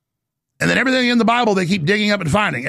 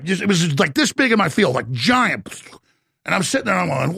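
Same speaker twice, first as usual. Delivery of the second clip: ordinary talk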